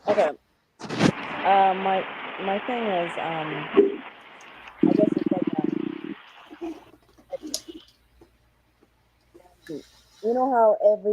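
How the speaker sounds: muffled through a bad mic; sounding muffled and far away. Real, not acted. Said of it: "You sound muffled".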